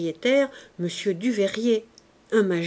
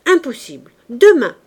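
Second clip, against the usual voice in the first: a concierge shouting down the new tenant; loud, curt, furious cry; 5 semitones higher, 13 dB louder than usual. A narrator's reading. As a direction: bark the refusal, loud and high. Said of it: "cria le concierge furieux".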